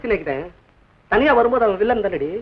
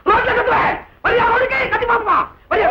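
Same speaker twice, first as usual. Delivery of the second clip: angry shout; 8 semitones higher than usual. Acted. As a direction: shout him down with a jeering laugh. Challenging rival to shout outside.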